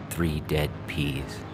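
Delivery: ordinary delivery, nothing marked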